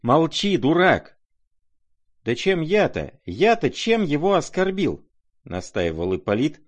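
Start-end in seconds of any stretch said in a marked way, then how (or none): 0.0-1.2 loud, high-pitched cry; shouted insult. A narrator's reading. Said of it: "закричал Коля". 2.2-5.0 high-pitched, needling protest; mocking insistence. A narrator's reading. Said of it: "тем же насмешливым тоном".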